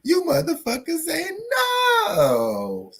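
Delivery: high wailing taunt dropping to a shout; cry-like high taunt; hype squeal. Acted. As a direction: wail it to tease them. Hyping fight day again.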